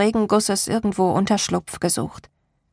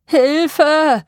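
high, loud desperate shout; distant cry for help; 7 semitones higher, 7 dB louder than usual. A narrator's reading. Unseen voice in the storm shouts for help. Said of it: "Die Stimme kam aus der Ferne".

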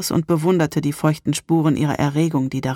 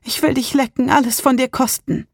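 much higher desperate begging, "will" stressed; desperate plea; 9 semitones higher than usual. Acted. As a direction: beg with rising desperation. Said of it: "rief sie verzweifelt aus".

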